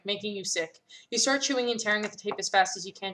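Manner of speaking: ordinary delivery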